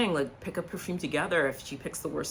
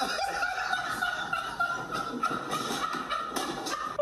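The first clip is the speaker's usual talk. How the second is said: breathless squealing laugh; helpless cackle at the cockroach line; high wheezing laugh; 6 dB quieter than usual. Real, not acted. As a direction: lose it in a high, airy laugh.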